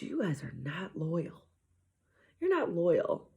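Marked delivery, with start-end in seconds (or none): 0.0-1.4 hushed, voiceless whisper of a scolding. Retold or imitated. Mom whispering to her kids that they are not loyal. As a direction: breathe the accusation in a whisper.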